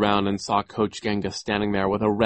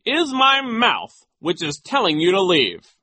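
very high, loud, stretched put-down; high-pitched imperious retort; Nero; 11 semitones higher, 7 dB louder, slower than usual. A narrator's reading. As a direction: order them out with pompous relish. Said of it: "Nero said".

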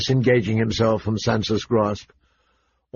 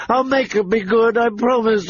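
put-on higher girl promising through tears; tearful promise; 11 semitones higher than usual. A narrator's reading. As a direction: promise desperately. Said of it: "She started to cry".

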